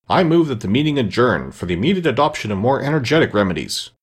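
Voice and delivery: ordinary delivery, nothing marked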